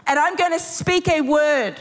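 high, emphatic preaching with conviction; full-force preaching. Real, not acted. Preacher declaring deliverance over the congregation, high and emphatic. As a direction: declare it over them with authority.

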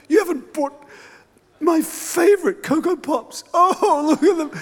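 high, mock near-tears gushing; hammed-up delight. Pretend child overjoyed at favourite cereal, near mock tears. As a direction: gush over the cereal like a miracle.

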